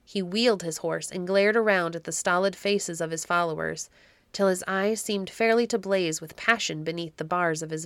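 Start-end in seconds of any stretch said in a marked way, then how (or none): none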